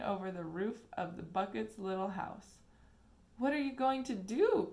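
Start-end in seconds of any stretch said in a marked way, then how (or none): 3.4-4.7 anxious cry. A narrator's reading. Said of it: "cried Charlie".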